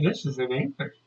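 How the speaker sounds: ordinary delivery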